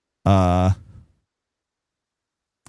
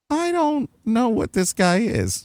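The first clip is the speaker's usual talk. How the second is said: slow, higher, softer puzzled musing; baffled aside; 10 semitones higher, 4 dB quieter than usual. Retold or imitated. Looking at his photos, a slow baffled mutter. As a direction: voice your own baffled reaction. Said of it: "I'm like".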